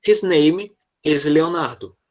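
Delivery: slow, clear teacher read of I am Rosângela; slow model line. Shown as a demonstration. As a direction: read it slowly for the class to repeat. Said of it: "A segunda ele fala assim". (leitura lenta e clara para repetir)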